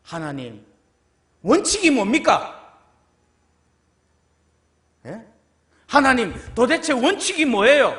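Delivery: loud insistent demand; demanding God. Acted. People demanding of God, loud and insistent. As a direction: press God for an answer. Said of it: "그 앞에서 우리는 뭐라고 이야기하는 거예요".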